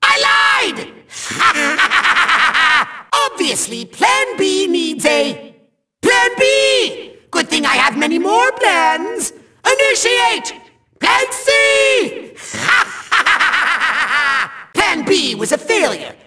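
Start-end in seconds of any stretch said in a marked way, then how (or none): 1.2-3.3 triumphant villain laugh; evil cackle. Acted. Villain admits he lied. 12.5-14.5 triumphant villain laugh; evil cackle. Acted. Villain launches a new plan.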